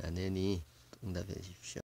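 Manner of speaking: usual speaking voice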